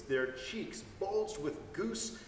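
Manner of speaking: ordinary delivery, nothing marked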